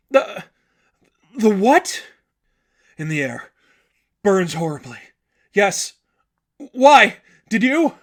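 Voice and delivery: high, loud startled questions; shocked and scared; frightened. Acted.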